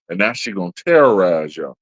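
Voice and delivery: ordinary talk, nothing marked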